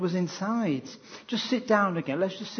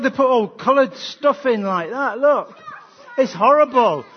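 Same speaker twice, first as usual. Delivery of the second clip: hammed-up disgust; 5 semitones higher, 5 dB louder than usual. Mock disgust at tin's contents.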